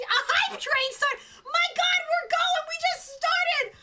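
high, loud, breathless excitement; thrilled disbelief. Real, not acted. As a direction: exclaim like it's happening too soon. Hype train starts on stream.

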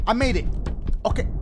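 ordinary talk

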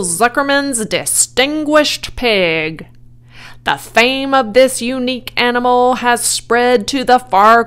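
loud, grand public address; loudspeaker announcer. A narrator's reading. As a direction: proclaim it pompously to a crowd. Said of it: "said the loudspeaker".

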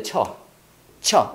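"처" as one short blended "ch" syllable. Shown as a demonstration. Teacher shows how T and U join, said as 처. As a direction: merge T and U into a single "처". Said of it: "이걸 처로 발음해야 돼".

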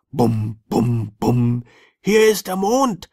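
high, loud furious yell; shouting. Acted. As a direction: bellow it like an angry little sandman. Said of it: "schrie der Sandmann sie an".

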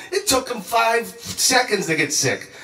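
normal talking voice

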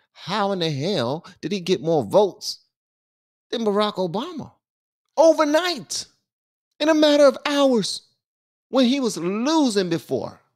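high-pitched, indignant disbelief; incredulous. Real, not acted. Commentator questioning an overnight vote jump, incredulous. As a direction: demand an explanation, pitch leaping up.

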